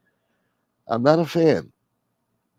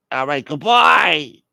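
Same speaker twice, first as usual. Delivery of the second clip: loud, drawn-out, higher farewell; hammed-up huffy sign-off; offended goodbye; 5 semitones higher, 6 dB louder, much slower than usual. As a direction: stretch it into a wounded send-off. Caller's second offended goodbye.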